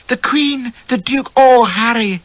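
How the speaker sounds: breathless delight. Acted. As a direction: swoon over the news. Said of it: "she kept saying".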